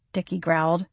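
ordinary delivery, nothing marked

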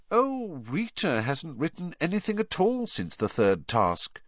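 high, held-back voice; oddly constrained. A narrator's reading. Hermione speaking of Rita, oddly constrained. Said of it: "in an oddly constrained voice".

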